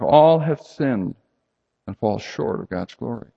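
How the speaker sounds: "All" hit hard, then "have sinned" drawn out slowly; slow stress on all. Shown as a demonstration. Preacher quoting scripture to the congregation, slow and stressed. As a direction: lean on the word All and take it slow.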